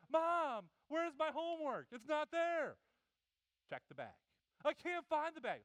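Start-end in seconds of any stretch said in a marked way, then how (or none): 0.1-2.8 high, loud calling out; kid calling mom. Retold or imitated. 4.6-5.6 high, loud whine; kid whining loud. Retold or imitated. Kid calling out.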